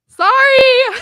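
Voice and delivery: high, loud sheepish sorry; a kid's half-guilty apology; mock sorry child. Niece apologizing for getting grandpa banned, high and loud.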